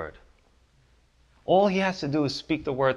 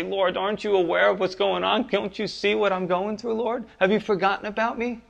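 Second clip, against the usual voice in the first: pleading prayer; 6 semitones higher than usual. Retold or imitated. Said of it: "and we say".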